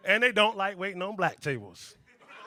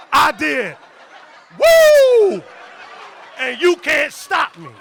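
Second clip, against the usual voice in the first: touchdown whoop, much higher and louder; wild celebration; 7 semitones higher, 16 dB louder, much slower than usual. Pretend. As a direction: celebrate like you just scored. Celebrating like an NFL player.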